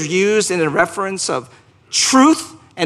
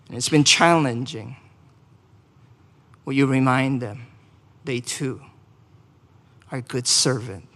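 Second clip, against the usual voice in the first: subdued prayer, sinking toward the close; pastoral prayer; 2 semitones lower than usual. Real, not acted. Leads congregational prayer.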